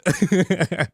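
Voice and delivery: a short burst of laughter; laughing. Real, not acted. Laughs at own joke.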